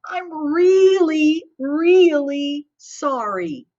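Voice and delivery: gentle, half-acted sob story; crying apology. A narrator's reading. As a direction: drag it out like a tearful little girl. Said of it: "Harriet cried".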